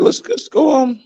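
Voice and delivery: chirpy high cartoon chicken pitching the idea; high chicken voice suggesting bacon and eggs. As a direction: squeak it out like an eager chicken. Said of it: "He said".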